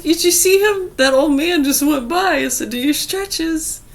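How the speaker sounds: excited retelling. Retold or imitated. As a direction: burst out with it to Mom.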